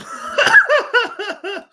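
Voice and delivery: loud high-pitched laughing fit; real cackle. Real, not acted. Laughing at the terrible chart.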